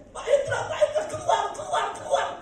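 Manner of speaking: high-pitched, urgent neighbour's call; imitated urgent calling. Neighbour calling preacher out during earthquake, urgent high calling. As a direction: call him out of the house urgently. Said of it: "Pak Herman sama Bu Herman teriak".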